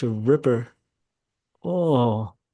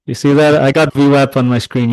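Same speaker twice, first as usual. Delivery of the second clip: louder, playfully defensive; mock-indignant protest; 2 semitones higher, 9 dB louder than usual. Real, not acted. Streamer loudly protesting to chat, mock indignation. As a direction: insist loudly, half joking.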